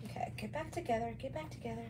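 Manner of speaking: soft, coaxing murmur to fabric; talking to fabric. Real, not acted. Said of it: "Yes, I talk to my fabric".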